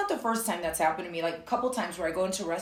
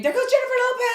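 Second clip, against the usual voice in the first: high shouted fan call; imitated scream; 8 dB louder, faster than usual. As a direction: shriek it like an excited fan. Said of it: "people would scream".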